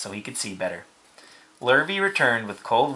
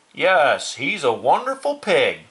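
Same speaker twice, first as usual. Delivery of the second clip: raised, emphatic character voice; admiring farmer; 5 semitones higher, 4 dB louder, slower than usual. Acted. Farmer studying the pig, raised admiring voice. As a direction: praise with a high, swooping emphasis.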